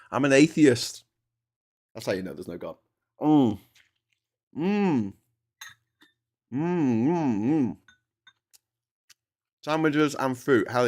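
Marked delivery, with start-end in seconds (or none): 3.2-7.8 drawn-out hums of enjoyment while eating; savouring mmm. Real, not acted. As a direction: hum with pleasure over a tasty bite. Savouring food on camera.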